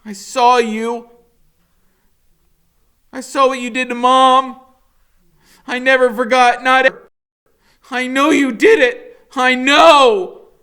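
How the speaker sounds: put-on a boy screaming his accusation at his mother's killer; accusing scream. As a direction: hurl it at him, every word a blow. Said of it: "and I'm going".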